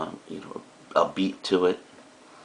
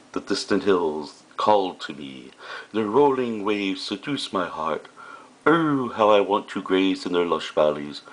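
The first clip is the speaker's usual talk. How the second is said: mock poetry recital. Teacher reads a Far Side cow's poem to students, mock-dramatic. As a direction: declaim it like an overwrought poet, stretching the vowels.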